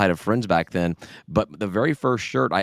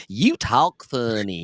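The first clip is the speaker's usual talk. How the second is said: put-on kid sister; 3 semitones higher, much slower than usual. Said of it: "My sister's like".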